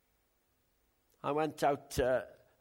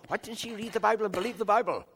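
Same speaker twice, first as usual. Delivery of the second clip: fast, higher, louder exasperated question; telling Mo, quick and exasperated, pitched up; irritated, exasperated; 5 semitones higher, 5 dB louder, faster than usual. Retold or imitated. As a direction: blurt out your frustration at her. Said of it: "my temptation is to get so irritated".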